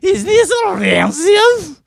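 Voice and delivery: high, loud hammed-up fortune-teller accent; over-the-top Slavic crone accent; mock Slavic accent. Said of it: "I'm not good with accents".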